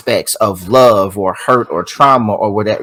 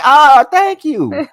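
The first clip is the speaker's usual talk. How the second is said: much higher, slow, put-on coy voice; coy thank you; 14 semitones higher, 3 dB louder, much slower than usual. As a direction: play the flattered friend accepting praise. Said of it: "You like".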